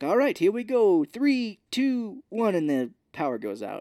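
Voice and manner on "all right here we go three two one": high, hammed-up count-in; imitated doctor countdown. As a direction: count down like an eager doctor, pitched up. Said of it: "And he's like".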